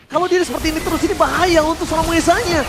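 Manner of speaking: building from quick talk to high, loud; esports caster. Real, not acted. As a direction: ramp up the hype with the fight. Caster building to team fight.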